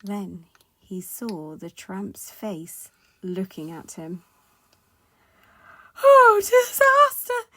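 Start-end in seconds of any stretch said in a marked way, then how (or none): 6.0-7.5 high, loud, drawn-out wail of panic; terrified scream. Acted. Mister Jelly wakes facing a giant, terrified scream. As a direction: scream it as if doomed. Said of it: "he screamed".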